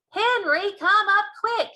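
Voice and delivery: high, loud motherly shriek; alarmed calling. Acted. Mummy summons her husband, loud and panicked. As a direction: call urgently to someone downstairs.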